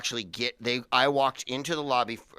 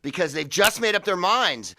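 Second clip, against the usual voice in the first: louder, slow, commanding; urgent call; 7 semitones higher, 6 dB louder, slower than usual. Retold or imitated. Comedian retelling how he ordered his agent, loud and urgent. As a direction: insist hard down the phone.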